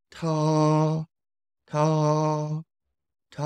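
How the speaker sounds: high level first-tone "Ta". Shown as a demonstration. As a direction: keep "Ta" level at the top of the voice. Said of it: "It's the same utterance".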